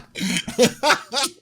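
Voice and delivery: high-pitched helpless laugh; real laughing fit. Real, not acted. Cracks up at the remark.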